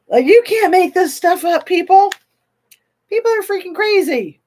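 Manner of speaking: high, loud exclamation of disbelief; loud, high-pitched incredulity; incredulous outburst. Real, not acted. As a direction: cry it out, astonished, high and loud. Exclaims at unbelievable true story.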